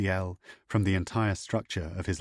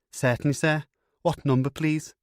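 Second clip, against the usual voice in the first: higher, bright Liverpool operator voice with a Scouse lilt; Liverpudlian operator; 7 semitones higher, 4 dB louder than usual. A narrator's reading. Liverpudlian operator answers Gaddis, thick Scouse accent. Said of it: "a woman with a thick Liverpudlian accent".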